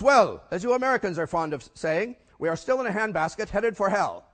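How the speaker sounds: loud mock German accent. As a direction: deliver the idiom stiffly in a German accent. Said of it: "a German accent".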